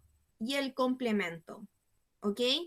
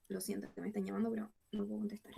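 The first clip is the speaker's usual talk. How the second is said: hurried, much softer apology to the class; quick quiet aside; 3 semitones lower, 9 dB quieter, much faster than usual. Real, not acted. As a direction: drop the voice and rush it out, fast. Aside about incoming phone call.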